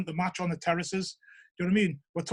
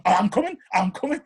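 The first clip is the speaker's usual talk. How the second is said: lightly voiced excited kid; imitating eager child; 5 dB louder than usual. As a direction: pipe up as a son who wants in. Young son begging to come along.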